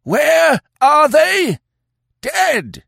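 very high, loud, drawn-out shriek; enraged chief screaming the answer; furious howl. A narrator's reading. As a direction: howl it at the peak of rage. Old chief's howl climbs in fury.

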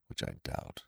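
whispered gloomy aside. A narrator's reading. Eeyore mutters his doubt to Pooh, whispered and gloomy. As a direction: breathe the doubt out. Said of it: "said Eeyore gloomily".

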